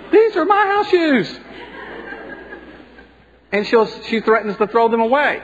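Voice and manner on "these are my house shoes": much higher and slower, laughing; indignant high pitch. Retold or imitated. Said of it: "and I say".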